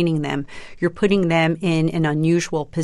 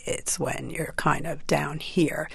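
vocal fry; 6 dB quieter, slower than usual. Shown as a demonstration. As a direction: drop down into a creaky rasp. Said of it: "demonstrated for us".

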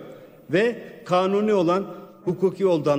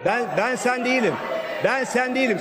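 raised, shouted retort; angry shout; 5 semitones higher than usual. Real, not acted. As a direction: shout it back at them.

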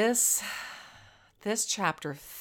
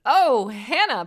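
higher, louder, slow mocking drawl; Penny mocking Hannah on the way to church, smirking; 5 semitones higher, 8 dB louder than usual. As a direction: let the smirk be heard. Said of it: "Penny smirked".

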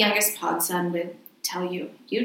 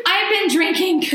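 laughing confession; 11 semitones higher, 6 dB louder, slower than usual. Real, not acted.

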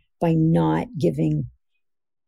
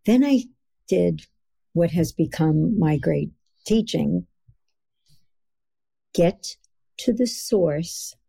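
ordinary delivery

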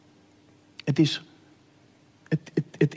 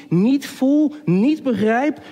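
usual speaking voice

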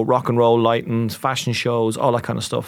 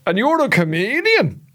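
higher, slower mock-sarcastic jab; sons mocking; 9 semitones higher, slower than usual. His two sons mocking their dad, slow, higher sarcasm. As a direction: drag it out like teasing sons. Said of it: "then you both go".

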